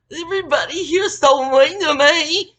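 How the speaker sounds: loud, high mock-crying whine; crocodile tears. Girl runs to the boss complaining, mock tearful wail. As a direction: wail it like a fake crybaby. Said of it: "with her big ass crocodile tears".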